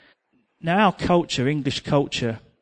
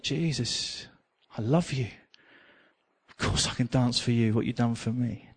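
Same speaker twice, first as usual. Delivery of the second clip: tender prayer; 4 semitones lower, 6 dB quieter than usual. Retold or imitated. Praying after repentance.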